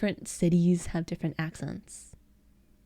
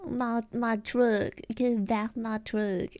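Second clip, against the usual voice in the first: hammed-up Southern drawl; southern accent; slower than usual. American streamer joking for viewers, hammed-up southern accent. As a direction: play a Southerner wanting the truck back. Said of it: "you like that southern accent".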